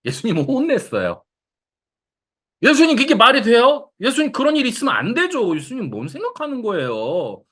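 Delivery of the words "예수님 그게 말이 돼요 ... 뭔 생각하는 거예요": Peter scolding Jesus. Retold or imitated. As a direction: rebuke him like an outraged disciple. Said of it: "하고 베드로가 예수님을 혼냈어요".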